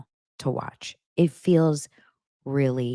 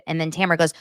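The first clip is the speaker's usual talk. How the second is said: ordinary delivery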